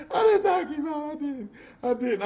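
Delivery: high, laughing, teary denial. Real, not acted. To the audience, laughing while denying it. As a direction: deny it while cracking up.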